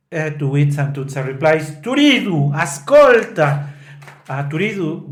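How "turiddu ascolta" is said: pleading call. Retold or imitated. Santuzza pleading retold.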